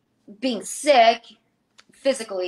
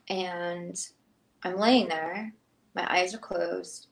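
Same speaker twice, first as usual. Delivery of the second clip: ordinary delivery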